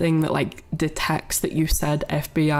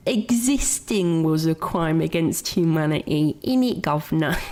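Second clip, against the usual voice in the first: mock Cockney. Streamer joking to chat in a hammed-up Cockney accent. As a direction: lay on the Cockney, innit. Continues mock British bit.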